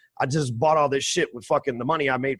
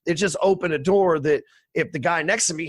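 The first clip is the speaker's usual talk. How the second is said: usual speaking voice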